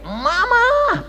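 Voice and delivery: very high, drawn-out call for mother; high-pitched mock yell; child's cry. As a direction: imitate a child calling out in a high voice.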